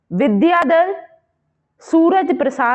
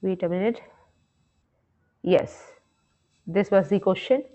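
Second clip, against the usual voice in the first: quiet aside; 6 semitones lower, 7 dB quieter, much slower than usual. Real, not acted. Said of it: "off-mic aside mid-lesson".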